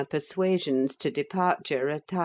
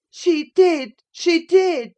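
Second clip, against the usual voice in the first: put-on high, loud childish chant; taunting singsong; 13 semitones higher, 10 dB louder, slower than usual. A narrator's reading. Linton enjoying his cousin's agitation, loud taunting singsong. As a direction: sing it out to goad her. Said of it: "sang Linton".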